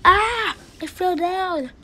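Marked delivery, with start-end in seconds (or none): 0.0-0.6 high, loud "ah" yell; pretend falling scream. Character who fell down, pretend falling scream. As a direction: cry out as if falling.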